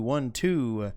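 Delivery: ordinary talk, nothing marked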